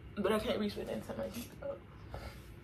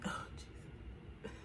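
slow breathy whisper; whispered exclamation; 18 dB quieter, slower than usual. Real, not acted. Vlogger sighing a whispered oh Jesus. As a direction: mutter it under your breath.

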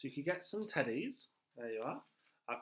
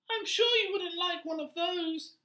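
much higher, louder squeaky rat; rat character; 8 dB louder than usual. Acted. Teacher voicing the pet-shop rat to children, high and loud. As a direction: play the pushy little rat, high and loud.

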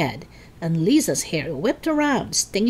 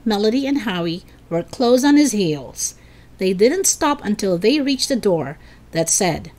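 ordinary delivery

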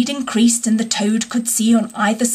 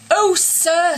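a touch of mock sobbing, higher; lightly voiced wailing washerwoman; crying; 7 semitones higher than usual. A narrator's reading. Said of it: "crying afresh".

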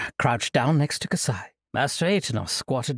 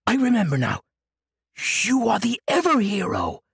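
higher, louder sudden realization; excited outburst; 8 semitones higher, 4 dB louder than usual. Acted. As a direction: burst out with delight. Old monk suddenly remembers, leaps up.

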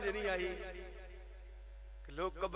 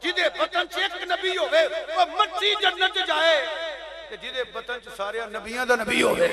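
loud, slow, weighty proclamation; booming sermon climax, words stretched out; impassioned preaching; 5 semitones higher, 11 dB louder, slower than usual. Real, not acted. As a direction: drive the argument home at full force. Preacher at full force.

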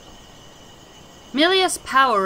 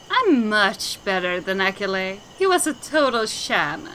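normal talking voice

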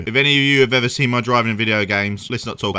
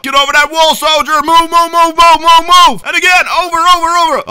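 much higher, louder American drill sergeant barking orders; 13 semitones higher, 9 dB louder than usual. Acted. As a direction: yell orders like an American boot camp sergeant. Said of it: "How's my American accent?".

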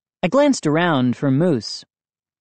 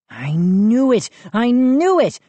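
higher, louder, triumphant; excited cry; 8 semitones higher, 4 dB louder than usual. A narrator's reading. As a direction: shout it like you cracked the case. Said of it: "I cried out".

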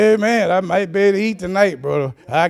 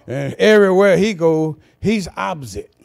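normal talking voice

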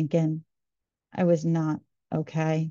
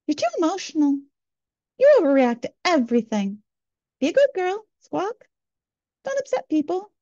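much higher, shrill-pitched nagging bird voice ending each line in a squawk; squawking mockingbird; 10 semitones higher than usual. The inner mockingbird scolds her to be a good girl, squawking high. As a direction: play the mockingbird scolding inside her head. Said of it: "the mockingbird of voices past would squawk".

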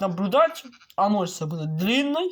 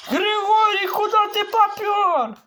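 high, slow scolding call; mock scolding call; 10 semitones higher, 4 dB louder than usual. Gamer calling after mob Grigory, mock scolding. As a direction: call after him like a fussy parent.

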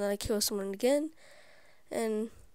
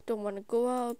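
usual speaking voice

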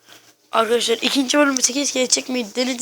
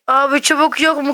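louder, brisk "Abi çubuk yok mu"; brisk, louder repeat of the complaint; quick exasperated ask; 4 semitones higher, 5 dB louder than usual. Real, not acted. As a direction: fire the question off fast. Asks again for sticks.

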